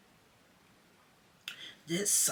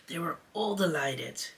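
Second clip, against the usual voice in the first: plain reading voice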